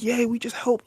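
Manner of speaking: high, loud breezy mimicry; mock airy consultant. Mocking a consultant friend who can't explain her job, airy and high. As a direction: squeak it out like a clueless consultant.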